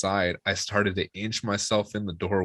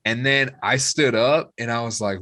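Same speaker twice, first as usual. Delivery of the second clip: ordinary talk, nothing marked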